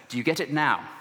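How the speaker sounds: lightly put-on British accent; playful British-accented question. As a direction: ask it as a British Saint John. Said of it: "why he has a British accent".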